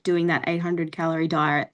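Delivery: normal talking voice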